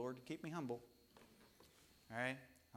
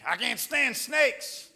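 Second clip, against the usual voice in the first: mock scared shout; 11 semitones higher, 15 dB louder, much slower than usual. Pastor retelling his snake fright, mock scared shout. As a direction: shout it high and loud, mock panic. Retold own reaction to snake.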